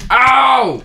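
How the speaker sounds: sharp loud ow; yelp. Real, not acted. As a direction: yelp in pain. Hit in game, cries out.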